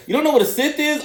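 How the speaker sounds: high-pitched incredulous question; mock disbelief. Star Wars fan to questioning girlfriend, high mock disbelief. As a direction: squeak it out in exaggerated disbelief.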